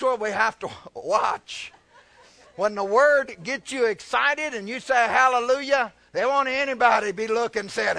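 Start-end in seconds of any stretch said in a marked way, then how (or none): 2.6-8.0 high, loud pulpit delivery; emphatic preaching. Real, not acted. Preacher applying the story to the rapture, emphatic. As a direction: drive the point home to the congregation.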